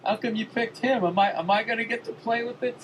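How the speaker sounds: a hammed-up hurt child asking to play; whiny kid Verzi. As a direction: beg like a left-out six-year-old. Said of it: "That was the look Verzi had".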